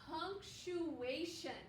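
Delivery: punctuation drawn out slowly, each syllable clear. Shown as a demonstration. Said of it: "Can you say that with me?".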